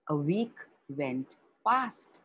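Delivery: plain reading voice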